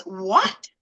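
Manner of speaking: mild mock yell of disbelief; retold yell. Obstetrician stunned in the busy hallway, mildly yelled. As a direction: play a shocked yell without full volume. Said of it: "she yells".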